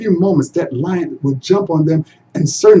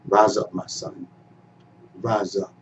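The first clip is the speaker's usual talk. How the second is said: low, soft comforting father; gentle father; 8 semitones lower, 7 dB quieter than usual. A narrator's reading. Father comforting his weeping son, low and soft. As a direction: lift him up gently.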